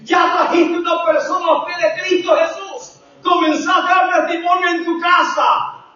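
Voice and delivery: high, ringing preaching call. Real, not acted. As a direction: challenge the congregation from the pulpit.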